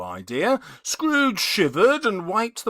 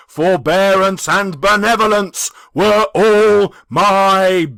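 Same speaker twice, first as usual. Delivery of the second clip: loud, heavy spectral declaration; a dead man's bitter lesson; passionate ghost; 7 dB louder than usual. Acted. The ghost speaks.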